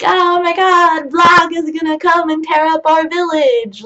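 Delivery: high, loud panicked elf shout; frightened tiny elf. Pretend. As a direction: scream like a terrified little elf. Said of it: "tiny little elves are afraid of".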